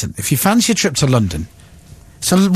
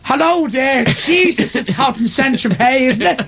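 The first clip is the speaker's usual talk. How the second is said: hammed-up, high Irish accent; excitable mock-Irish lilt; 7 semitones higher than usual. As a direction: put on a comic Irish voice. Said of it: "That's how Irish people talk".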